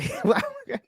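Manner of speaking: high-pitched burst of laughter; high squealing laugh. Real, not acted. Laughing at friend's joke.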